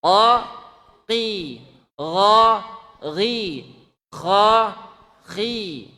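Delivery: qa, gha, kha heavy and loud, qi, ghi, khi lighter; teaching letter pairs; heavy versus lighter letters. Shown as a demonstration. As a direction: contrast the full and kasra letters. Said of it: "qaf jadi qi".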